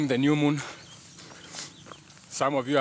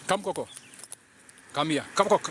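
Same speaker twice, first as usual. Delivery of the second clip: calling the dog; 4 semitones higher than usual. Real, not acted. Owner calling dog to come.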